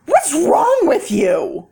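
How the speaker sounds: mock outraged stranger; rude stranger. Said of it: "someone say".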